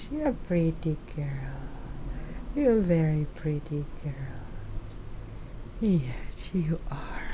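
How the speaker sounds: tender cooing to the pet cat; soft cooing. Real, not acted.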